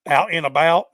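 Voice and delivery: Southern US "out and about", a stretched flat a; Southern American "out and about"; Southern drawl. Shown as a demonstration. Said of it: "That's, that's Southern".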